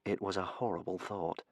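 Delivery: usual speaking voice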